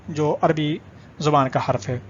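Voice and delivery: ordinary talk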